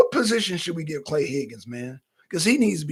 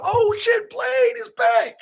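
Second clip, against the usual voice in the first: excited shout; slower than usual. Retold or imitated. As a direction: shout it like a thrilled fan. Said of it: "I said".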